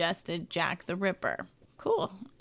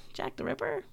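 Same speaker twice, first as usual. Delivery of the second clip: whisper; 11 semitones higher, 8 dB quieter, faster than usual. Pretend. Spooky name dropped in whisper.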